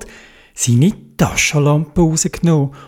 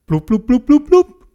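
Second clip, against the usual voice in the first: bubbling sounds; 8 semitones higher than usual. A narrator's reading. Bubbles rising underwater.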